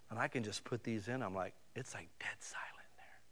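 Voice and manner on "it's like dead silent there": hushed whisper; very soft, awed whisper. Acted. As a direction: drop right down to a whisper. Acting out dead silence with earbuds in.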